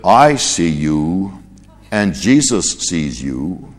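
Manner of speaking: slow, drawn-out eerie parrot; a hidden bird speaking slowly out of the dark; spooky parrot voice. Acted. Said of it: "something spoke and said".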